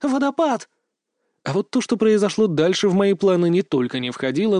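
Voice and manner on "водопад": high-pitched frantic exclamation; sudden high yelp; panicked spell cry. A narrator's reading. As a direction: cry out a spell as you fall. Panicked girl cries out a spell.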